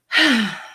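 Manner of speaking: loud, exaggerated longing sigh; wistful sigh. Married speaker lets the doggy date go, wistful sigh. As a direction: sigh over what might have been.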